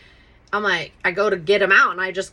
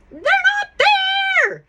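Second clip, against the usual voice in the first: much higher, louder disbelieving squeal; can't believe the keys are gone, shocked squeal; 6 dB louder, slower than usual. Real, not acted. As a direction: shriek it in disbelief. Keys missing from the ignition.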